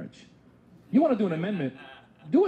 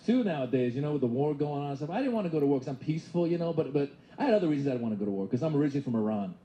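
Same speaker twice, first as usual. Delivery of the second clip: usual speaking voice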